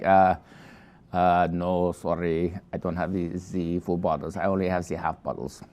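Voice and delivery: a clumsy imitation of a French wine merchant apologizing; mild mock French accent; bad French accent. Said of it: "That was probably a really bad French accent".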